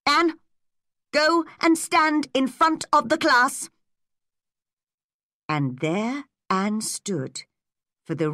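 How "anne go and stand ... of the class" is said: raised, stern teacher's command; strict schoolmaster giving punishment; firm. A narrator's reading. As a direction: order the pupil sharply, higher and louder. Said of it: "said Mister Phillips firmly".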